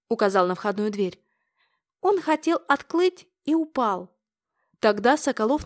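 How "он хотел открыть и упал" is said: высоко и медленно, как малыш; говорить как испуганный ребёнок; детский голос. A narrator's reading. Ребёнок объясняет, всхлипывая. (малыш рассказывает, что дед упал)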